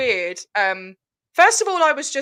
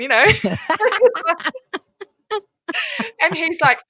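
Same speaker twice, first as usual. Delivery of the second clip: normal talking voice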